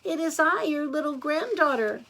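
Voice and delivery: put-on high, sweet girlish voice. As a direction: announce yourself as an innocent granddaughter.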